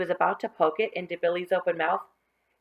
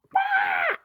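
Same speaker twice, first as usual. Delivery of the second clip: much higher sudden shout; scary pounce shout. Acted. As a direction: jump out and scare them. Billy pounces to scare them.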